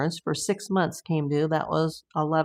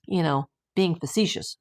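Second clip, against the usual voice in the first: ordinary talk, nothing marked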